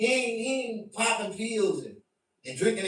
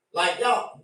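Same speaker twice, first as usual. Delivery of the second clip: ordinary talk, nothing marked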